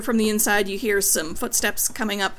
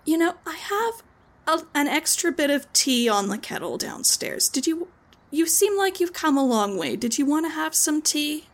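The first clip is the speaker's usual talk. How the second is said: higher, gentle offer of tea; kind hostess; 6 semitones higher than usual. Acted. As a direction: invite the tired courier in kindly.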